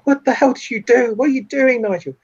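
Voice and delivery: high, loud, horrified outcry; hammed-up shriek of disbelief; screaming freak-out. Woman sees Nigel stab their friend, freaking out. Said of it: "she sort of screams and just sort of freaks out".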